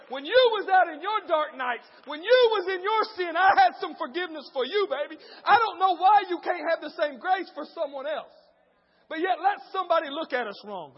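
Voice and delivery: loud, shouted sermon climb; full-force preaching. Acted. Pastor calling the church to grace, shouting. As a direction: preach it hard to the crowd.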